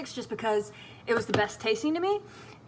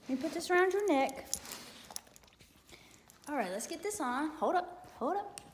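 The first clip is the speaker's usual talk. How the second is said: soft, higher coaxing to a nervous dog; soothing the dog; 4 semitones higher, 6 dB quieter than usual. Real, not acted. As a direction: hush him gently, like calming a scared puppy.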